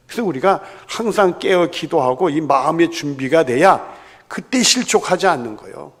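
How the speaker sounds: ordinary talk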